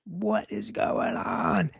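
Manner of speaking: slow, hushed and high, bewildered; panicked. Retold or imitated. Terrified disciples in the storm, slow hushed panic. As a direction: let the fear drain the voice. Fear takes over in the storm.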